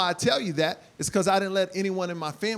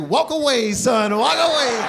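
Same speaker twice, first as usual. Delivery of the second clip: weary dad; 4 semitones higher, 6 dB louder than usual. Retold or imitated. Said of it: "My dad's like".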